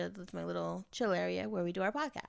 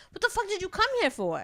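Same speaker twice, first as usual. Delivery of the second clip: incredulous outburst; 10 semitones higher than usual. Retold or imitated. Shocked retort to his come-on.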